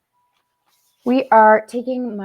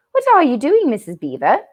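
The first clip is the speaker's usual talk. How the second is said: put-on high girl's voice, exclaiming; a young girl's startled question, pitched up; Susan exclaiming; 6 semitones higher than usual. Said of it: "exclaimed Susan".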